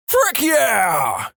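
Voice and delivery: high, drawn-out scream back; screamed reply. Acted. Catchphrase guy answers girl across class, screaming. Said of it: "he immediately screams".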